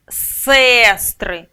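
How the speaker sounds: "сестри" with a loud, drawn-out first е; stressed clear е. Shown as a demonstration. Teacher showing the check word, "сестри" stressed clearly on е. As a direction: punch and stretch the first vowel of "сестри". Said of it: "чітко, бо він під наголосом".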